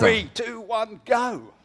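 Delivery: loud, high countdown call; excited countdown. Real, not acted. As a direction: count him in like a race start. Host counts down the start.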